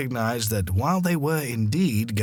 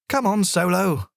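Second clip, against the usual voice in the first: calling out; 9 semitones higher than usual. A narrator's reading. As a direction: call the dog to come along. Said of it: "called out Mum".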